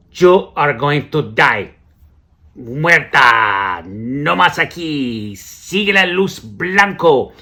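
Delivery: very slow, booming mock translation; loud drawn-out pronouncement. Clueless translator to sick passenger, slow and loud. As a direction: stretch every word out loudly, as if to someone who barely understands. Acted bad Spanish to sick man.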